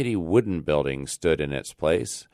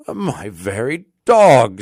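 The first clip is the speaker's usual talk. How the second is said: sighing; 4 semitones higher, 10 dB louder, slower than usual. Acted. Rip grieving his dog forgot him, loud and slow lament. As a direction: let the hurt swell out louder. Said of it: "sighed Rip".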